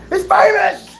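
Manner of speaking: high-pitched excited squeal; imitated screaming fan. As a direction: scream it like a starstruck crowd. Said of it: "screaming at me".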